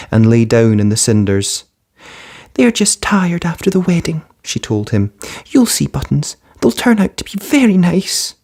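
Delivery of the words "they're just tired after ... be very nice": higher, gentle hopeful voice; hopeful girl. Acted. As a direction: reassure the dog kindly. Cinderella hopeful.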